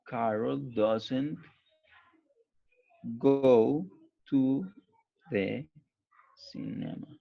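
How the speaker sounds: very slow, each word spaced out with pauses; word-by-word dictation. Shown as a demonstration. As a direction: dictate it one word at a time. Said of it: "teacher dictates word by word". (dictado lento, palabra por palabra)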